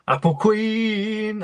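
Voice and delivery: high, held-out greeting; drawn-out call. Real, not acted. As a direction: stretch it out long and high.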